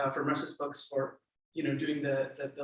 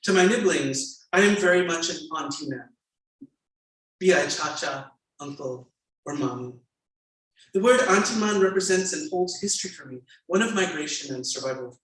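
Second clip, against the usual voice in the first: louder, measured reading aloud; memoir reading; 3 semitones higher, 7 dB louder than usual. A narrator's reading. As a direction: read the passage to the audience with weight. Author reads memoir aloud.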